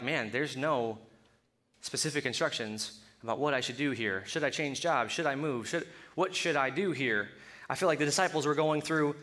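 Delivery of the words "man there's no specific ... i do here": lower, softer voice, as if thinking aloud; quiet pondering. Acted. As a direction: mull it over to yourself, unsure. Voices a person stuck over decisions.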